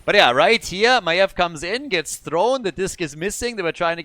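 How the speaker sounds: excited esports caster; animated play-by-play. Real, not acted. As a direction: drive through the fight recap with energy. Recapping a team fight live.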